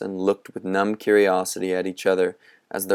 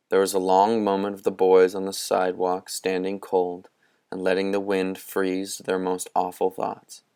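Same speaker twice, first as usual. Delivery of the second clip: ordinary delivery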